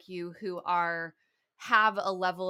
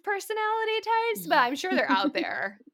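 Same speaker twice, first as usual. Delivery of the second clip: amused; 12 semitones higher, 6 dB louder than usual. Real, not acted. Joking aside about expulsive types.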